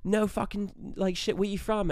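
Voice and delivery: high, excited mock Australian; Australian accent. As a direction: imitate an amazed Australian lad. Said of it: "these kids were also Australian".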